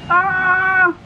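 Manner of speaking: high screeching cry of rage; screeching devil; devil's screech. A narrator's reading. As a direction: let out a shrill furious screech. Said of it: "screeched the devil".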